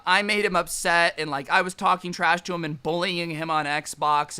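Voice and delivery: ordinary talk, nothing marked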